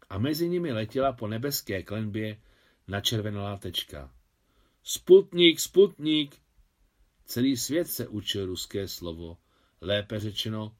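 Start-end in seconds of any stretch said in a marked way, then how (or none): 4.8-6.4 high, loud cry of Sputnik; shouting. A narrator's reading. People on balconies shout at the satellite, excited. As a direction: call it out to the whole street.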